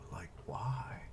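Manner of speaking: unvoiced, puzzled whispered question. Real, not acted. Puzzling over the manga's style, whispered. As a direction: whisper it to yourself. Puzzled aside while reading.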